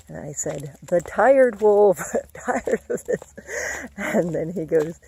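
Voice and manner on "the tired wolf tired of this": slow, louder mock-weary answer, breaking into a laugh; hammed-up weary reply dissolving into laughter; mock tired wolf. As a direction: drag it out like you're worn out, then laugh. Mom answers son, mock weary.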